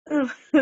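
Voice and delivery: sudden high, loud laugh. Real, not acted. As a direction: burst out laughing at what was reported. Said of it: "like you just started laughing right now".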